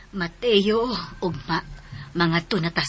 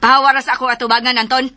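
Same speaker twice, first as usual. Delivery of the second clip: furious mother ordering him out; loud, high and fast, driving him away; angry shout; 6 semitones higher, 4 dB louder, faster than usual. Acted. Mother angrily sends Anton away.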